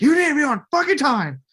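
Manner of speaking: high, loud mock yell at the star. New director yelling at Vin Diesel, mock yell. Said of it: "And he's like".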